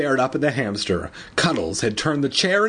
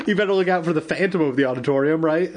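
laughing; 5 semitones higher, faster than usual. Real, not acted. Laughing spooky aside to listeners.

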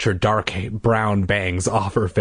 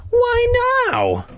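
high sister voice. Acted.

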